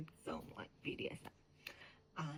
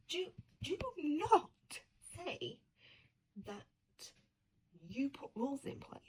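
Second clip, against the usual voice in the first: stern scolding; 9 semitones higher, 4 dB louder than usual. Acted. Scolding the character.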